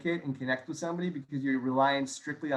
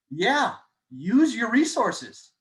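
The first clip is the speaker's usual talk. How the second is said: hyped; 6 semitones higher, 4 dB louder, much slower than usual. Real, not acted. Pep-talk punchline to sales team.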